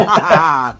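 gloating villain laugh; evil laugh. Retold or imitated. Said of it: "Evil J D F laugh".

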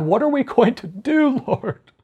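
high-pitched imitation of a worried whine to God; hammed-up plea of dismay; mock complaining. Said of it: "Then he complained to God".

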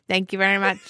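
put-on foreign sitcom character voice; Latka from Taxi. Mimicking Mars Boy as Taxi's Latka, accented voice. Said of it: "He sounds like Andy Kaufman in".

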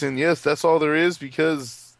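ordinary talk, nothing marked

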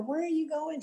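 high, stunned disbelief; disbelieving. Retold or imitated. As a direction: ask as if you can't believe it. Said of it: "She just couldn't believe it".

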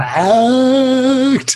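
very drawn-out, high "act"; stretched mock-theatrical delivery; hammy thespian. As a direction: declaim it like an overacting stage actor. Hammed-up actor quip.

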